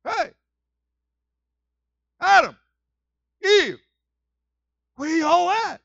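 very high loud calls across the garden; God calling for Adam and Eve, loud. Pretend. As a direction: shout for them like a searching father. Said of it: "That'd be God".

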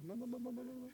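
tiny, high, soft chatter; talking hands. Pretend. Preacher imagines his hands telling him things, tiny high chatter. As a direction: play hands nagging in a little squeaky murmur. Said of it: "telling me stuff, just talking to me".

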